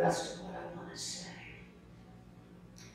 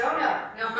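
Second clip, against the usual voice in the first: flustered refusal; 3 semitones higher, 6 dB louder than usual. Retold or imitated. Retold flustered refusal of invitation.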